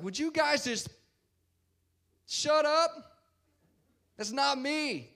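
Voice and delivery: acted, high-pitched angry protest; mock angry outburst. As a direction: snap at the accusers, acting fed up. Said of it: "he starts cussing at him".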